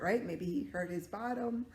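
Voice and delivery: usual speaking voice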